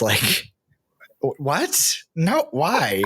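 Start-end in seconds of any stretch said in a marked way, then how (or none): 1.2-3.0 higher, baffled disbelief; incredulous guide. Retold or imitated. Guide Wasey reacting to a silly stalking request, incredulous. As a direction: act like the request is absurd. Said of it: "he looks at me like".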